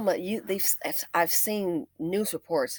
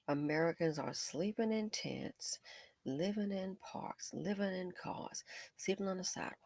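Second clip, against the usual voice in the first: hushed lament; 15 dB quieter than usual. Real, not acted. Vlogger lamenting Americans sleeping on sidewalks. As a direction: whisper it like grief.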